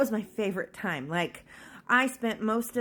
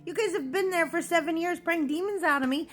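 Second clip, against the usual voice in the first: high, loud, exasperated protest; frustrated outburst; 8 semitones higher, 3 dB louder than usual. Retold or imitated. To leaders who refused to pray, high loud angry protest. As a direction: let the frustration burst out, pitched high. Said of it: "I was so angry and so frustrated".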